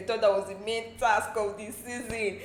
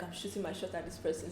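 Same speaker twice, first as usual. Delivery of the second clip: inner voice; 3 semitones lower, 12 dB quieter than usual. Retold or imitated. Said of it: "deep down you're like".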